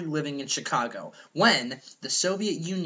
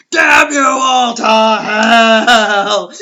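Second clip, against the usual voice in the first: slow, loud, drawn-out cry of despair; Taylor's despairing scream, slow and loud; anguished shout; 5 semitones higher, 14 dB louder, much slower than usual. Acted. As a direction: bellow it at the sky in ruin. Planet of the Apes ending.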